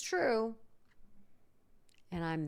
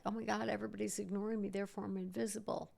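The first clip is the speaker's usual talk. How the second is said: quick, rushed mock panic; mock dramatic self-pity; 3 semitones higher, 3 dB quieter, much faster than usual. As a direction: rattle it off like an overdramatic worrier. Mocking dramatic self-talk.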